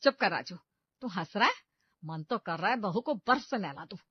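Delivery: loud, sharp rebuke of her son; angry snap. Acted. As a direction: shut the laughing son up. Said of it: "गुस्से से राजू को भी डांट लगाती है".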